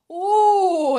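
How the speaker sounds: high, loud, drawn-out "oh"; hungry dragon. Acted. Dragon greeting the witch, big greedy delight. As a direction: play a greedy storybook dragon.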